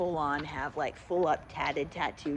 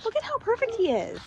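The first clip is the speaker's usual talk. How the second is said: talking to a pet. Real, not acted. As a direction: coo at your dog. Talking sweetly to the dog.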